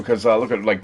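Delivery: normal talking voice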